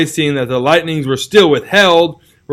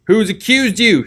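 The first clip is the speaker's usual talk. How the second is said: shout; 7 semitones higher than usual. Acted. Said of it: "shouted a voice".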